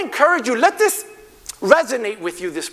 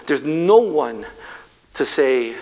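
ordinary talk

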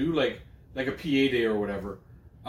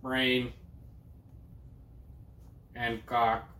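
slow, flat robotic monotone; even, machine-like delivery; Stephen Hawking voice; 6 dB quieter, much slower than usual. Pretend. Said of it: "This is my Stephen Hawking voice".

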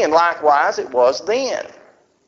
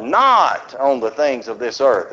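ordinary delivery

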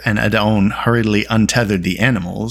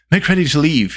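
urgent call to get moving, raised in pitch; cried order; 6 semitones higher than usual. A narrator's reading. Bard to his companions to make ready to leave, urgent cry. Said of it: "he cried".

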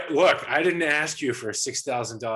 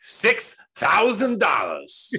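slow, drawn-out screaming movie tough guy; Al Pacino; 10 semitones higher, 8 dB louder, much slower than usual. Retold or imitated. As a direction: bellow it slowly, high and loud. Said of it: "I just do Al Pacino soundboards".

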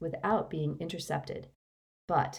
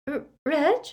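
higher, hesitant question; confused; 10 semitones higher, 5 dB louder than usual. A narrator's reading. Mrs Cattermole seeing two husbands, bewildered. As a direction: stammer his name in bewilderment.

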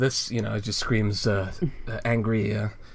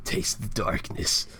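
edgy villain line; 2 semitones lower, slower than usual. Retold or imitated. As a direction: breathe it like a wannabe dark lord. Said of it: "says something edgy".

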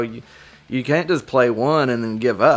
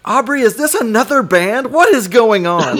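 alarmed dad; 9 semitones higher, 4 dB louder than usual. Retold or imitated. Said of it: "he's like".

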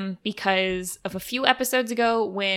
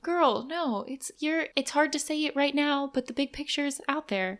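higher, softer reassuring voice; Kirsten; 5 semitones higher, 5 dB quieter than usual. Retold or imitated. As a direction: lightly imitate a soothing friend, pitched up and soft. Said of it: "much more like how Kirsten actually did".

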